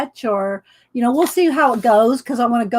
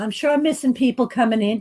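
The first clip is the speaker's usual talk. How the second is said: ordinary delivery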